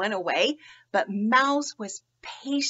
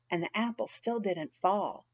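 ordinary delivery

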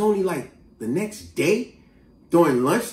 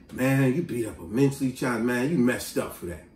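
lower, softer mock reproach; imagined disapproval; 7 semitones lower, 6 dB quieter than usual. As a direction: imitate onlookers quietly shaming someone. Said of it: "what people would've said".

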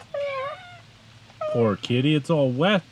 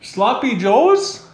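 surprised; 10 semitones higher, 5 dB louder, much slower than usual. Real, not acted. Repeats odd dish name, amused.

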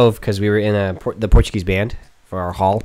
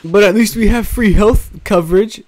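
mock British accent; 11 semitones higher, 5 dB louder than usual. Mocking British people's reply.

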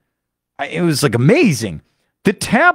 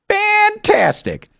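Fantastic slow and much higher; much slower than usual. Shown as a demonstration. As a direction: lift Fantastic way up, drawn out.

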